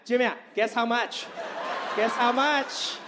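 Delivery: put-on loud accented mom nagging; imitating mom. Son imitating mom showing off a new watch, loud. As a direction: push him to guess the price. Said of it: "She would be like".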